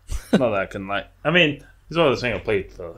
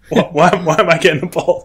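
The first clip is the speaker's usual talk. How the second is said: fast, louder, higher, laughing through the panic; 7 semitones higher, 9 dB louder than usual. Retold or imitated. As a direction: rattle off the protest in comic alarm. Retold panic about getting ball.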